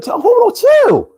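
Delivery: high-pitched urgent protest; urgent calling out. Acted. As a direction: cry out to stop someone, voice leaping high. Acted scene, stopping the shorty.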